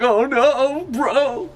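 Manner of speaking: high, hammed-up wail of dismay; mock panicked Roger. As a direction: whine it like a smitten pirate giving up. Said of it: "He's like".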